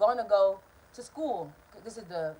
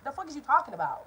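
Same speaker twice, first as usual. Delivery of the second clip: usual speaking voice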